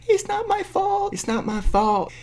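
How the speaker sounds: very high, slow whine; mock whiny denial. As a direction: imitate her wailing in self-defence. Mocks the character's whining denial.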